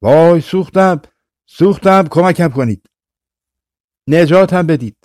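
high, loud cries of a burning badger; screaming in pain. Badger shrieking for help as it burns on the stove. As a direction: yell for help as if scalded. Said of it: "فریاد زد".

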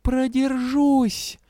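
high, very drawn-out kitten retort; hissing kitten. A narrator's reading. Kitten vowing to last till the wedding, hissing. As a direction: hiss it slowly as a stubborn kitten. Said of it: "прошипела царапка".

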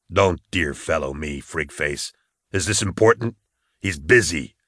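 low, curt bounty hunter; gruff rebuff. Acted. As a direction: brush him off in a low, surly voice.

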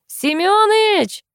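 very high, drawn-out holler; full-throated call. A narrator's reading. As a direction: call a neighbour from afar, stretching the name. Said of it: "во всё горло крикнула". (протяжный крик во всё горло)

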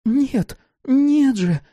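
put-on high, childlike pleading; childish babble. A narrator's reading. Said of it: "по-детски пролепетала Давид".

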